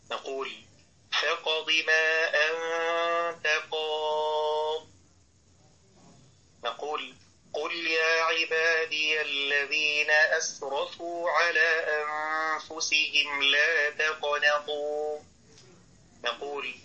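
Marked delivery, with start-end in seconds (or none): 1.1-4.9 slow, soft chant with a bouncing q in فاقض; qalqalah recitation. Shown as a demonstration. Teacher demonstrating qalqalah to students, slow recitation. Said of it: "فلا بد من بيان القلقلة والشدة". 7.5-15.2 slow soft chant, bouncing q in تقنطوا; qalqalah recitation. Shown as a demonstration. Teacher demonstrating a Quranic example, slow qalqalah recitation. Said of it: "فلا بد من بيان القلقلة والشدة".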